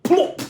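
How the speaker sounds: high loud plop; plop sound effect. A narrator's reading. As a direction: pop it out sharply.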